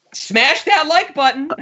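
high, slow hype plea; YouTuber voice. Pretend. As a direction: ham up a YouTuber outro. Mock YouTuber sign-off.

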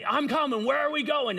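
high, eager and loud; eager sidekick. Acted. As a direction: jump in like an eager helper. Eager armor bearer voiced.

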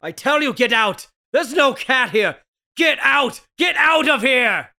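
angry shouting. Acted. Man yells at the policeman to get out of his house, angry shouting. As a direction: throw the neighbor out, shouting.

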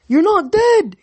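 high, loud burst of joy; loud, high-pitched excitement; excited exclamation. Acted. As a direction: cry out in amazed delight. Tyson greeting Percy excitedly.

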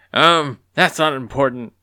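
loud, flustered brush-off; hurried evasion. A narrator's reading. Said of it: "Apollon replied quickly".

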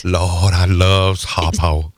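low, slow put-on drawl; Oprah film character. Quoting Oprah's film role to co-hosts, low slow imitation. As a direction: do Oprah's slave role, drawn out. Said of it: "You ever notice that with Oprah?".

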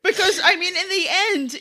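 high, breaking into laughter; laughing. Real, not acted. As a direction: talk through giggles. Giggling fit mid-sentence.

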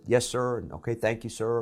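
soft, polite yes sir; quiet respectful reply. Retold or imitated. Student answering the judge, quiet and polite. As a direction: murmur it respectfully. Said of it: "And I said".

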